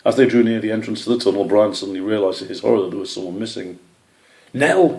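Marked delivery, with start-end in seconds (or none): 4.5-5.0 loud, high panicked shout. A narrator's reading. Brian shouting for missing Nell, alarmed shout. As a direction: yell her name in alarm. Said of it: "he shouted".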